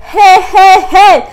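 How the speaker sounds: sharp high yelps of panic; reliving a panicked shriek. Retold or imitated. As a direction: cry out high and loud. Reliving her panicked scream.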